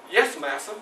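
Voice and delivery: low, soft, submissive answer; hushed, deferential acknowledgement; meek reply. Acted. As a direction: answer the master quietly and meekly. Slave answers master's request.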